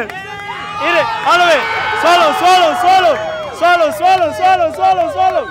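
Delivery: loud chanting cheer; frat bro. Real, not acted. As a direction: chant it, hyping him up. Cheering contestant on to finish.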